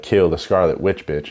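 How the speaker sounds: ordinary talk, nothing marked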